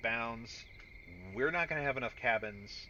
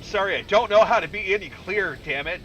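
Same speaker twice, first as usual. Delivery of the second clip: loud, raised, fed-up rant; exasperated yell; 6 semitones higher, 14 dB louder than usual. Acted. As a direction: blow up in mock frustration. Mock exasperation at the game.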